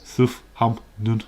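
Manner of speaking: a loud, slow, teasing Southampton; drawn-out Southampton. Shown as a demonstration. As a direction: stretch Southampton out in a broad blur. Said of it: "When would it be pronounced".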